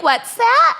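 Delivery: high puzzled voice; imitating a confused stranger; confused local. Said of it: "She was like".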